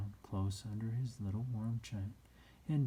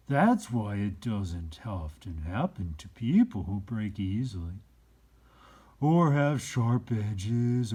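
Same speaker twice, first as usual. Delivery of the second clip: put-on slow, loud and higher, an old toy's gentle wisdom; wise old Skin Horse; 4 semitones higher, 10 dB louder than usual. A narrator's reading. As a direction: speak as the patient old horse, drawing it out.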